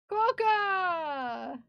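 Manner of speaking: high, loud delighted cry; excited shout. Real, not acted.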